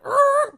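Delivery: very high, loud vocal screech; imitated screeching turn. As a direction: screech like a sudden swerve. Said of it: "take that drastic, like, left turn".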